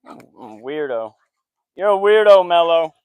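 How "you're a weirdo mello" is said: loud teasing call. Real, not acted. Calling out to the dog.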